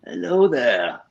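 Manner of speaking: put-on a wise old mentor, slowly; Alec Guinness impression. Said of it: "And I'll say".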